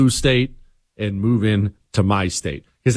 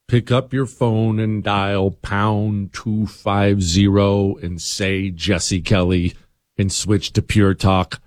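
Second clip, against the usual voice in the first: slow, lower ad read, each word laid out; 3 semitones lower, slower than usual. Acted. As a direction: spell out the call to action slowly. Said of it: "ad read call to action".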